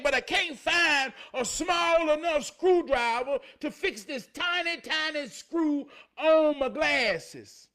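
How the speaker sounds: loud, high, driving preacher's cry; fired-up preaching. Acted. Bragging to the crowd about his tools, at full preaching force. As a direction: build it up like a revival shout.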